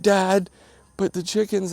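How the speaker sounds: a hint of whiny children begging dad; imitating the kids. Dad voicing kids' plea.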